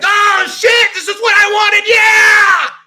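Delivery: very high, loud ecstatic shout; thrilled kid yelling. Acted. Kid tearing open his wanted toy, shrieking. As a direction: scream with pure joy.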